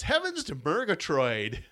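lightly voiced Snagglepuss, slow; a hint of the cartoon lion.

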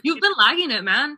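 loud, high-pitched teasing complaint; mock scolding. Friend chiding her for lagging on bullying, loud mock scolding. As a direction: scold a friend loudly, in jest.

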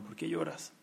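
very soft, hushed question; gently asking. Retold or imitated. Rabbi asks the crying boy why, gently. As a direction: drop to a tender near-murmur.

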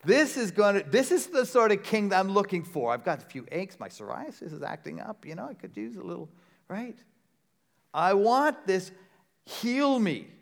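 mock greedy delight at the new king; a hint of a self-serving crowd wanting healing; eager crowd voice. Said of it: "And we're saying".

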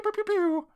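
high zappy pew pew pew effect; fireball pew. Shown as a demonstration. Host explaining game buttons to co-host, high zappy fireball sound. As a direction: zap out the fireball noises. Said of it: "make them go pew".

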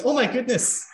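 high, startled priest voice. Acted. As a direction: gasp it out like a shoved priest. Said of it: "He goes".

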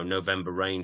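ordinary delivery

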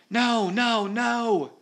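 high, loud repeated cries; shouting. A narrator's reading. Peter striking the monster again and again, shouting. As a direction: shout it with every blow. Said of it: "he shouted".

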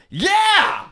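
high, loud excited yeah; eager yell. Retold or imitated. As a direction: shout it out eagerly. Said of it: "And Paul went".

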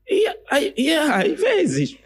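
high, slow, coy mock self; retelling his own sheepish answer in a high put-on voice; mock shy reply. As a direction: squeak it bashfully.

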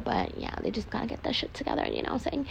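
hushed aside. Real, not acted. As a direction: drop to a soft, breathy aside. Quiet aside to listeners.

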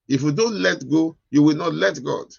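loud, driving preacher's call; preaching at full force. Acted. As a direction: hammer it home to the congregation.

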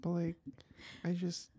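put-on high, halting kid voice; scared eight-year-old. Shy kid confessing a crush at school, mock scared. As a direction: stammer like a nervous schoolchild. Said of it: "like, eight-year-old".

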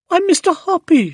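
very high, loud, delighted surprise; crying out. A narrator's reading. Woman hears a marriage proposal, crying out in surprise. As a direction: exclaim it high, taken aback and thrilled. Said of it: "she cried".

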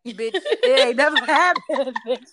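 laughing, defiant denial; mock Virgo denial. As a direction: deny it flat out, half laughing. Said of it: "A Virgo is going to act like".

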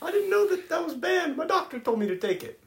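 squeaky high innocent voice; high mock voice. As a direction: play dumb in a high falsetto. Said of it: "He was like".

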